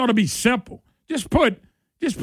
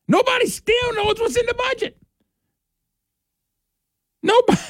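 incredulous; 13 semitones higher than usual. Real, not acted. Incredulous at budget mess.

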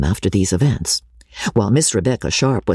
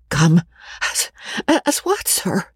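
hesitant, breathless question; flustered young woman; 7 semitones higher than usual. A narrator's reading. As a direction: she can hardly believe what she hears. Becky asks, flustered.

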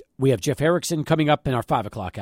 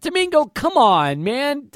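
incredulous; 9 semitones higher, slower than usual. Real, not acted. Host can't believe coach retired.

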